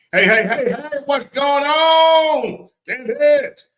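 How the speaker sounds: high, loud, drawn-out shout; hollered greeting. Real, not acted. Host greeting Candace mid-talk, hollered greeting. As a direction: holler a hello across the room.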